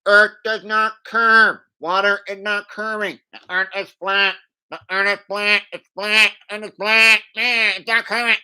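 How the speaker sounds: loud, high, honking megaphone voice; bullhorn imitation. Activist preaching to the street by bullhorn, mock blaring. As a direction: blare it out as if through a bullhorn. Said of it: "he goes outside with a bullhorn".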